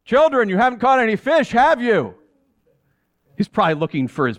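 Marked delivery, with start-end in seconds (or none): 0.0-2.1 raised, carrying call to the boat; calling out from the shore. Acted. Stranger on the beach calls to the boat, raised call. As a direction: call out across the water to the fishermen. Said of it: "he called to us".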